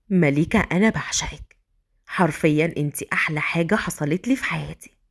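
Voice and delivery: intimate declaration to his bride; man in love, tender. Acted.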